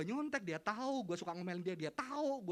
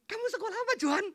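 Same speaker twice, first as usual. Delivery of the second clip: eager question; 12 dB louder, faster than usual. Retold or imitated. Classmate asking eagerly.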